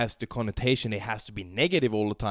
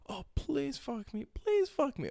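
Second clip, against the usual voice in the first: much higher, slow begging voice; mock begging girl; 6 dB quieter, slower than usual. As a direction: plead like she can't wait.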